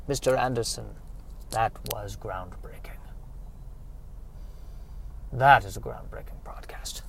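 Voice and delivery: soft, fading into near-whisper; hushed, grave praise; hushed awe. Acted. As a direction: quote an awestruck admirer under the breath. Quoting awed listeners in absurd story.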